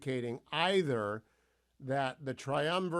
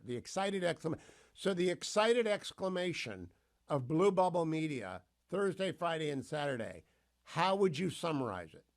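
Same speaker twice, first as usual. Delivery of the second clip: ordinary talk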